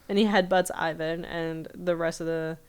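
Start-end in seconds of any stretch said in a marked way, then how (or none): none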